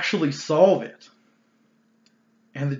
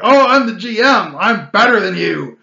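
much higher and louder, hammed-up arrogance; mocking the GM who thinks he is better than the players, loud sneer; mock arrogant GM; 4 semitones higher, 7 dB louder than usual. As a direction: crow it like a petty tyrant.

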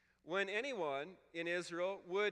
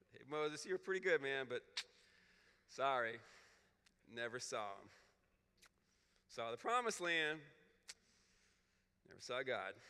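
mock put-down; 7 semitones lower, 3 dB quieter than usual. John's put-down aimed at Moses.

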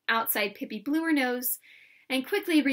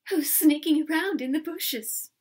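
gasp, then higher frightened voice; theatrical fright, raised pitch; 5 semitones higher, 4 dB louder than usual. Acted. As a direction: ask like a scared stage countess. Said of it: "said in a frightened voice".

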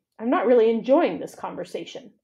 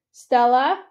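louder call across the dark; 3 semitones higher, 8 dB louder than usual. A narrator's reading. Ivan calls to Stella next door, louder call. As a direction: call her name across the enclosures. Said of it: "I call".

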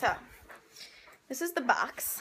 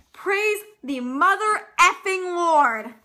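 slow, much louder jubilant proclamation; loud mock praise; 5 semitones higher, 8 dB louder, much slower than usual. Real, not acted. Vlogger celebrating that the laptop case fits, slow and loud. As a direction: proclaim it slowly like a hallelujah.

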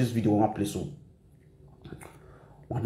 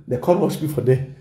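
ordinary talk

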